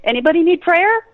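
loud, high woohoo; excited whoop. Retold or imitated. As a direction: whoop it up with delight. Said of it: "Then you're just like".

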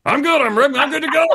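put-on high, chirpy old-man voice; breezy, unbothered old doctor; carefree old surgeon. Old surgeon telling the speaker he's ready to die, chirpy.

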